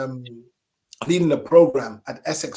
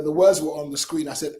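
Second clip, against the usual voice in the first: normal talking voice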